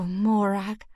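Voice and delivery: plain reading voice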